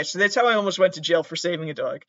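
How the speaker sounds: normal talking voice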